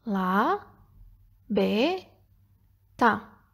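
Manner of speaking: single syllables with long pauses between; spaced out for filling columns; isolated syllables. Shown as a demonstration. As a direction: dictate them one at a time. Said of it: "com as sílabas da palavra".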